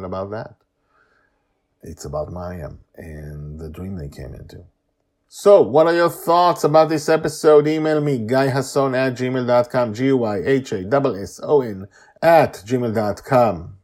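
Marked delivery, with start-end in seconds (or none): 5.3-13.8 loud, high host sign-off; announcer outro. Acted. Host asking listeners to email, loud announcer read. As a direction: invite listeners to email, upbeat.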